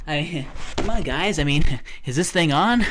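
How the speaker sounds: loud, high, pleading comic on stage; a comedian begging a dead crowd; bombing comedian. Retold or imitated. Voicing Kevin as a comic bombing on stage, loud and high. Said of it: "Kevin's just like".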